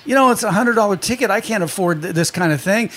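usual speaking voice

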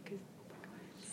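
barely audible mumble; trailing off into near silence; very quiet. Real, not acted. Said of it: "You're so quiet".

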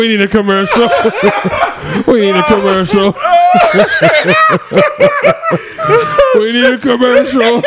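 words squeezed out between laughs; laughing. Real, not acted. Host laughing too hard to go on, calling for a break. Laughing fit, repeating line.

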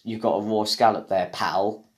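ordinary talk

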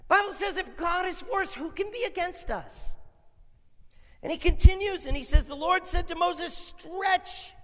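high, loud, emphatic sermon voice; full-force preaching. Real, not acted. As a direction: proclaim it to the whole congregation.

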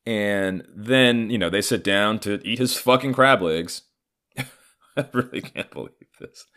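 usual speaking voice

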